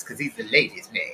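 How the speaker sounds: lisping ladies' man impression; put-on lisp; Ladies Man lisp. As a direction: talk with a little lisp like a smooth movie character. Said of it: "he talks like this".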